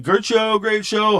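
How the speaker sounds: high-pitched quoted congratulations; high, friendly imitation of show chatter; mock post-show praise. Acted greeting after the show.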